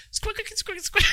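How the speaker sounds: high squeaky mouth imitation of a DJ scratch; squeaky scratch noise. Mocking the AI's guitar solo, a high squeaky scratch. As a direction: squeak it out like a record being scratched. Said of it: "the solo was a D J scratch".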